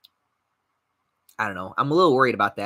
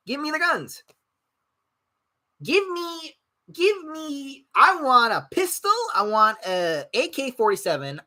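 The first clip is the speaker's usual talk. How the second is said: greedy demand; 11 semitones higher than usual. Real, not acted. Streamer comically demanding guns, high excited pleading.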